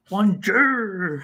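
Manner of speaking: put-on slow, drawn-out bonjour in a Scottish accent; French greeting through a thick Scottish accent, like the groundskeeper. Mocking the cartoon teacher, Scottish-accented bonjour. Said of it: "you've gotta say it with a Scottish accent".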